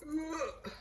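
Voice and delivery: squeezed high groan; strained high grunt of effort. Real, not acted.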